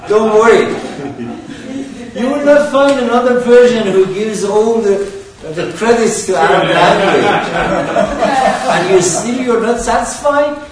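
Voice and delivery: loud, high, laughing exasperation; amused outburst, voice pitched right up; joking protest. Real, not acted. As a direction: protest loudly over the room's laughter.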